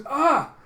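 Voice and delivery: high, very loud "Ah!"; pained scream. Acted. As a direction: scream as your arm is cut off. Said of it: "as he screams".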